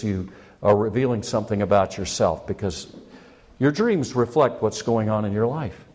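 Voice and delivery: ordinary delivery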